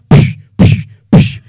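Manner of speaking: loud unvoiced psh psh psh; shushing sound effect. Batman swooping on blockers, loud sharp shush noises. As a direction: blast them with hissing pshs. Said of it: "just be like".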